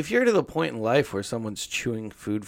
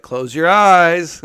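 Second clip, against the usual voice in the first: teasing game voice; 5 semitones higher, 10 dB louder, much slower than usual. Pretend. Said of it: "I'd be like".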